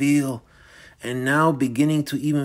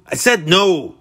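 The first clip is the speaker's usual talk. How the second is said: raised, higher-pitched outburst; angry refusal; 5 semitones higher, 4 dB louder than usual. Acted. Michael cutting off Dorian's repeated question, angry refusal. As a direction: shout down the question.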